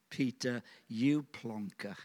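ordinary talk, nothing marked

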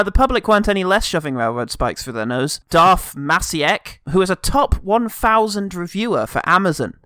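ordinary talk

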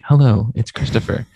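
low, smooth radio host voice; NPR voice. Shown as a demonstration. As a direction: put on a public radio announcer voice. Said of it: "Like, I'm N P R".

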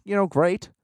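ordinary talk, nothing marked

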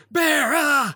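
high, loud retold yell; yelled warning. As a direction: act out a panicked shout. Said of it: "Greg yells".

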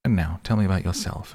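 ordinary delivery

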